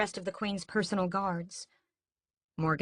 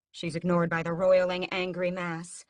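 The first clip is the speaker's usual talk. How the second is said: plain reading voice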